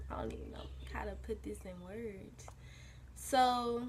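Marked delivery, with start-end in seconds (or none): 0.1-2.5 hushed, breathless whisper. Real, not acted. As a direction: drop to a whisper. Awkward aside about the breakup.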